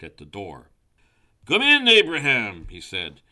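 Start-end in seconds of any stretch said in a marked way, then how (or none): 1.5-2.7 high, loud owl welcome; calling out. A narrator's reading. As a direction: call out to a visitor at the door. Owl calls visitor inside.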